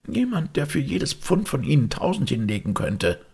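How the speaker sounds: high-pitched defiant taunt; scornful. Acted. Maid scoffs at Holmes's ten pounds, high and scornful. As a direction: boast scornfully at the detective.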